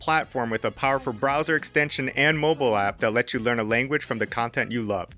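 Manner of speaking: upbeat, polished ad read over music; bright, energetic read of a sponsor spot. Acted. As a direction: sell the product cheerfully.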